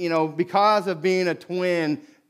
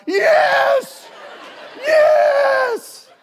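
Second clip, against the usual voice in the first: put-on cheering kids; 7 dB louder than usual. Boys cheering after the fight.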